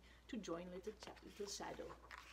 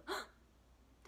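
gasp; 6 semitones higher, 5 dB quieter than usual. Acted. Reaction to complaint.

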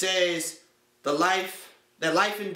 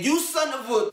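mock angry cuss-out; 6 semitones higher than usual. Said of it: "He's probably saying".

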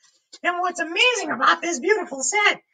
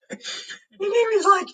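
normal talking voice

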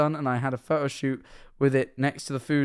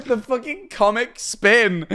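laughing; 7 semitones higher, slower than usual. Real, not acted. Laughing at a clip.

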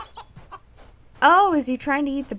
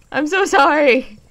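raised, pleading apology; loud apology; 3 semitones higher, 8 dB louder than usual. Real, not acted. Player apologising to the terrifying creature, loud and pleading. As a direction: call out an apology over nerves.

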